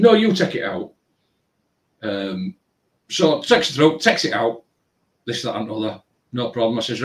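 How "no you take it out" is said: high-pitched mock whine; much higher, petulant imitation; mocking the girl. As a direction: whine it like a stubborn teen. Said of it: "She said".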